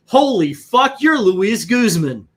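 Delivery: high, loud slow realization; starstruck exclamation. Retold or imitated. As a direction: gasp it out as the penny drops. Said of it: "I go".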